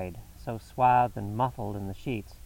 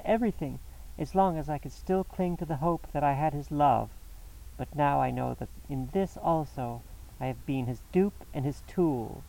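much higher, anguished woman's voice; 6 semitones higher than usual. A narrator's reading. Mrs Stapleton tells Holmes how her husband tortured her, anguished and much higher. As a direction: pour out her betrayal.

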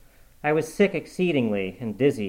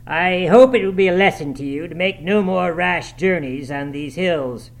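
loud, raised stern rebuke; stern master calling across a room; stern cry; 6 semitones higher, 6 dB louder than usual. A narrator's reading. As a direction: lecture them sternly, stretching the last words. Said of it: "cried Heathcliff's stern voice".

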